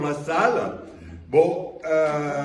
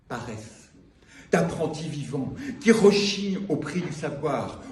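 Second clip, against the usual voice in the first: measured spoken-word reading; poetry recital; 3 semitones lower than usual. Acted. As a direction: project each image, then let the cadence fall. Reads second poem aloud.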